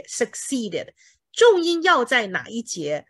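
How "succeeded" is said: succeeded as suk-SEE-ded; succeeded with weight on the middle syllable; second-syllable stress. Shown as a demonstration. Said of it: "第二音节".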